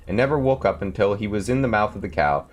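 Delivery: ordinary delivery, nothing marked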